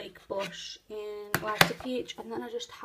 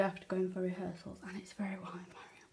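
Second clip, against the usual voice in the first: whispering; 2 semitones lower, 7 dB quieter than usual. Real, not acted. Quiet aside while getting ready.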